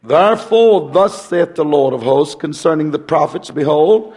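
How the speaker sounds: ordinary delivery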